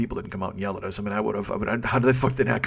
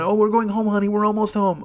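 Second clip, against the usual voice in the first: soothing friend; 10 semitones higher, 5 dB louder than usual. Retold or imitated. Friend soothing drunk passenger.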